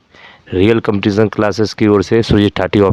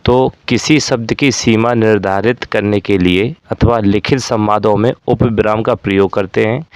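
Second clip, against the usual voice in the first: usual speaking voice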